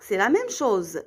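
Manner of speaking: ordinary talk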